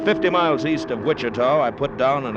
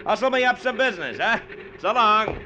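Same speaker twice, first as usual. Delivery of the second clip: raised voice over the roar, pitched up; calling out over the engine; 11 semitones higher than usual. Acted. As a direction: shout it over a running propeller. Shouting over plane engine.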